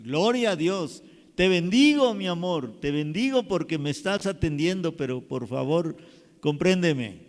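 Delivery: loud, fervent thanks to family; grateful patient. Shown as a demonstration. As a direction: bless the caregiver with grateful warmth.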